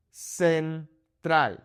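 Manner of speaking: Central split into sen, TRAHL; Spanish "Central" in two separated syllables. Shown as a demonstration. Teacher focuses on vowel sounds, Central in Spanish syllables. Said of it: "focusing on the vowel sounds".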